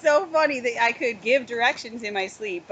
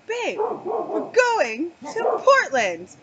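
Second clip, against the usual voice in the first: insistent husband; 7 semitones higher, 4 dB louder, much slower than usual. Retold or imitated. Husband insists on wrong route.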